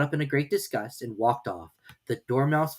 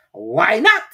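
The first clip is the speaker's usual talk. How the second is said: loud retort; curt hare comeback; snappy March Hare; 7 semitones higher, 10 dB louder than usual. Acted.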